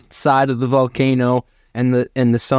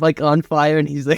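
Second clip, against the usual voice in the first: ordinary delivery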